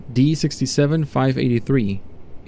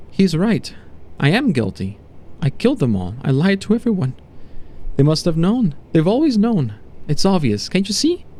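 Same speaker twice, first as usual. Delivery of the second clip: anguished confessing subject; 4 semitones higher than usual. Acted. Subject to doctor admitting the killings, anguished. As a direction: confess with pitch leaping in distress.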